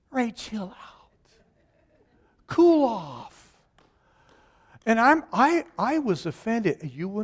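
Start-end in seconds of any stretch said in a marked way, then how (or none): 0.1-3.6 whispered chill out; whispered calm-down. Retold or imitated. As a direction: soothe him in a whisper. Pastor whispering.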